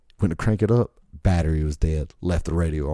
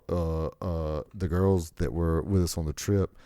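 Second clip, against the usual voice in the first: normal talking voice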